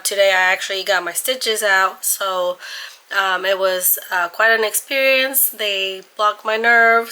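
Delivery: normal talking voice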